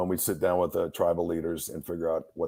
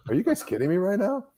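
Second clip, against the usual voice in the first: incredulous; 11 semitones higher than usual. Retold or imitated. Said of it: "And I'm just like".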